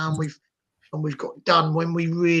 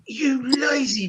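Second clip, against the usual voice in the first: slow, higher, scornful jab at the players; mock angry jibe; 7 semitones higher, slower than usual. Fan rebuking lazy defenders, slow scornful jab. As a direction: drag it out in disgust. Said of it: "like, so angry".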